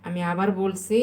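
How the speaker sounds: usual speaking voice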